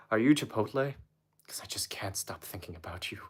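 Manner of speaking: soft, sinking into a whisper; hushed pickup line. Pretend. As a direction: whisper it like an intimate come-on. Redoes pickup line as seductive whisper.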